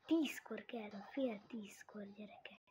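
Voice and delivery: whisper. Real, not acted. Whispered aside.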